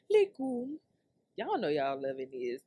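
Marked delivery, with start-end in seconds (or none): 0.1-0.8 "Lukewarm" jumped up high and loud, rising. Shown as a demonstration.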